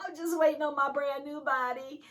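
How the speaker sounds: high, loud longing cry; jubilant, lifted preacher voice; eager exultation. Real, not acted. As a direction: sing out with eager joy. Excited preaching about the rapture.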